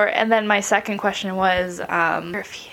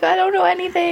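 laughing mock helpless; 10 semitones higher, 3 dB louder, faster than usual. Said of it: "I'm like".

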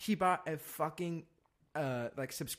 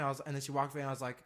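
ordinary talk

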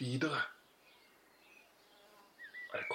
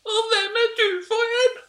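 very high, very loud squawk; hammed-up screechy challenge; Dronning Sonja; 11 dB louder than usual. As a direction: demand it as a shrieking comic queen. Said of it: "to meter fra dronning Sonja".

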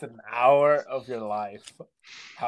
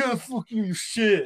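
high-pitched mock abuse; mock aggressive heckler; 7 semitones higher than usual. Viewer abusing a harmless streamer, mock aggressive taunt. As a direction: imitate a troll lashing out, pitched up. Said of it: "why are you so aggressive".

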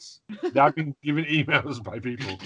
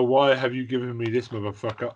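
ordinary talk

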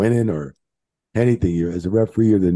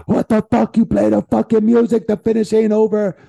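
high, loud imitation of irate officials; mock angry yelling; 14 semitones higher, 6 dB louder than usual. Angry officials berate the referee over early music, mock yelling. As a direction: yell it high and outraged, imitating them.

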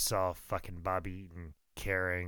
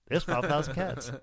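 understated punchline, breaking into laughter; Bobby Eaton; 5 semitones higher than usual. Retold or imitated. Bobby stepping outside to see the cats, conceding. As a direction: concede it, barely holding the laugh. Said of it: "And Bobby's like".

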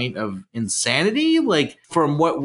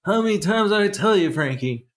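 lightly voiced clipped gangster impression; a hint of a Walken gangster; Christopher Walken; 7 semitones higher than usual. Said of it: "that's my Christopher Walken".

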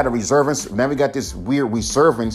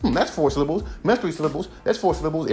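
higher, musing nerdy mimic; mock syllable counter; 5 semitones higher, faster than usual. Vlogger mimics listeners counting syllables, higher fussy musing. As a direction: count them off like a fussy critic.

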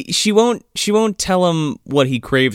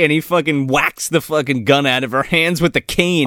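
ordinary talk

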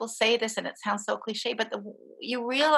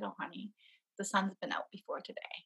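much softer, tender and careful; very gently; 13 dB quieter than usual. Retold or imitated. Husband gently correcting his grieving wife, much softer. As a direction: ease it to her gently. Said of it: "very gently he said".

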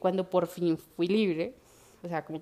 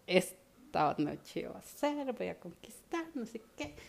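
mock romantic hush; 7 dB quieter, slower than usual. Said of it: "toda romántica".

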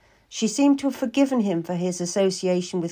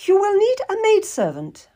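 high, commanding noblewoman; Lady Maria; 13 semitones higher, 4 dB louder than usual. Acted. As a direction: pitch it high, like an old lady taking charge. Lady Maria instructs the couple.

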